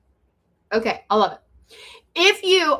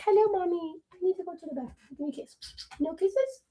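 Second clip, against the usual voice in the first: lightly voiced dog, higher and softer; voicing her dog; 5 semitones higher, 7 dB quieter than usual. Playing her dog asking mommy for the bathroom, light high pet voice. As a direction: speak for the dog in a small high voice. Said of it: "She's like".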